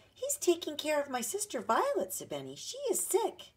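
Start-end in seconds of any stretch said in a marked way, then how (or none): 0.1-2.1 put-on high, earnest child's voice; little boy. A narrator's reading. Small boy explaining to a visitor, high child voice. 2.5-3.5 put-on very high, slow child's voice; little boy. A narrator's reading. Small boy telling visitor of his sister, high child voice.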